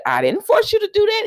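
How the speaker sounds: high-pitched protest, imitating her denial; indignant retort. Woman denying blame to her boyfriend, high indignant retort. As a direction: toss back the denial in a high voice.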